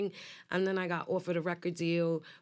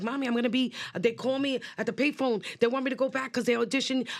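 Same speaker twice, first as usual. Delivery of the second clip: excited gushing; 5 semitones higher than usual. Retold or imitated. Girl bursting with news to her mother, excited. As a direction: blurt it all out to mom, thrilled.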